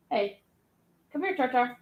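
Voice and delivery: calling the dog. Real, not acted. Calling her dog over.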